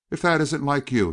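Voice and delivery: mild exclamation. A narrator's reading. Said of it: "he ejaculated".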